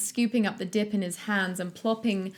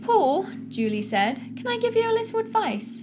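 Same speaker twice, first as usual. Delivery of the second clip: perky Julie advising; 12 semitones higher, 3 dB louder than usual. Acted. Julie telling tense Paul to relax, high sugary coaxing. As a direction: coax him like a chirpy coach.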